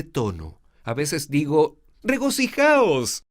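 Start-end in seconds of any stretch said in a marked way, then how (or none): 2.0-3.2 Regocijaos pitched very high and slow; soaring high Regocijaos. Shown as a demonstration. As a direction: lift Regocijaos way up, rejoicing. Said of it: "Ah, muy alto".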